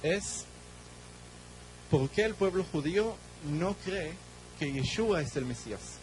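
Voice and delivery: ordinary talk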